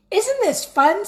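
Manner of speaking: high, bright-eyed glee; gleeful. Acted. Wendell gloating to Sophie, high gleeful cheer.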